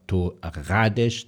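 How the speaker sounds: torradas said slowly with a trilled R; slow torradas, rolled R. Shown as a demonstration. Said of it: "double R".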